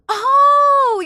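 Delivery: long, high, shouted "oh" of discovery; loud realization. Real, not acted. Streamer finally getting a viewer's name, loud realization. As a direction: cry out as it suddenly clicks.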